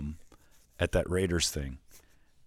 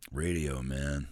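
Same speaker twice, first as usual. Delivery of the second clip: lower, softer mock Gruden; 5 semitones lower, 4 dB quieter, much slower than usual. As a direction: caught doing the impression. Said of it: "I'm like".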